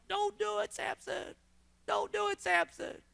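soft, high pleading warning; hushed urging. Retold or imitated. Watcher softly pleading with Samson, hushed plea. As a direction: whisper it like a spectator begging him to stop.

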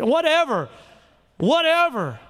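normal talking voice

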